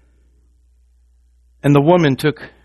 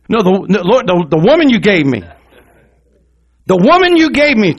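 loud, stumbling excuse, rising higher; acted blame-shifting; 6 semitones higher, 7 dB louder than usual. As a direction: protest loudly and pass the blame. Acts Adam blaming the woman.

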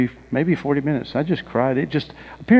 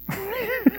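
high laugh; 7 dB quieter than usual. Real, not acted. Laugh after listing burdens.

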